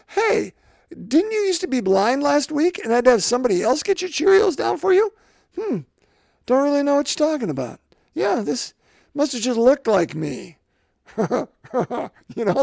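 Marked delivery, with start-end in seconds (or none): 0.1-5.1 high, fast mock-incredulous stranger; nosy shopper. Stranger at the grocery store questions the healed man, high and fast. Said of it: "somebody says to me". 6.5-10.6 fast, higher mock-innocent dodge; evasive healed man. Healed blind man dodges a shopper's question, mock innocent. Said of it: "somebody says to me".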